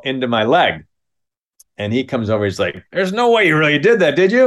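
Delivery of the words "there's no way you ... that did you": incredulous. Retold or imitated. Kid disbelieving the staple.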